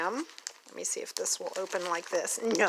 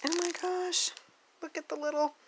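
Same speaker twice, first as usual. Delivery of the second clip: excited squeal; 9 semitones higher than usual. Real, not acted. Delight at finding more.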